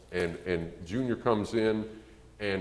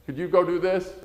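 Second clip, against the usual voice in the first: calling across the house; 10 semitones higher, 4 dB louder than usual. Retold or imitated. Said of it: "and you said".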